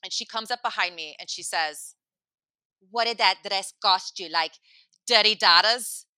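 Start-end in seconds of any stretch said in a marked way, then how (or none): 2.9-6.1 mushy, barely intelligible questioning; imitating a hard-to-understand woman; garbled drunk woman. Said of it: "I don't know if you understood me".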